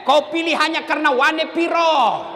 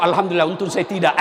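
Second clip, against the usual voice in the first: fast laughing mock relief; 8 semitones lower, faster than usual. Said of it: "dia bilang satu".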